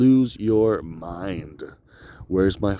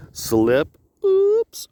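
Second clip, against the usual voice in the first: high squeaky oops; mock slip; 6 dB louder than usual. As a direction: playfully fake a fall. Acting out slipping off cliff.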